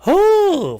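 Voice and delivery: very high, loud whoop of relief; a real, loud release whoop; loud whoo cheer. Real, not acted. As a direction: let out a big whoo. Cheer after rant.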